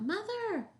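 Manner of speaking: put-on storybook child calling out in a high pitch; little chicken's shout. A narrator's reading. Little chicken shouts for its mother, calling high. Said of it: "heard the little chicken shout".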